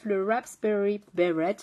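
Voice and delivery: slow, hammed-up English accent; English accent, drawn out; put-on English accent. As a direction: show off a lightly English accent on the product name. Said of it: "Vous avez vu cet accent".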